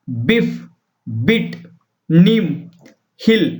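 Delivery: each word said slowly and clearly, with a pause after it; slow word list. Shown as a demonstration. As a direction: read them out one by one, like a teacher. Reads a phonics word list. (धीमे-धीमे साफ़ शब्द पढ़ना)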